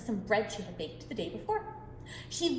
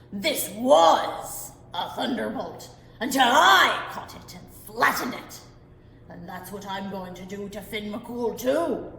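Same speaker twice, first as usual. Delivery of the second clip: boastful giant threat; 3 semitones lower, 7 dB louder, slower than usual. Acted.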